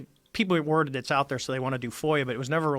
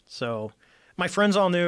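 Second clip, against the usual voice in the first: ordinary talk, nothing marked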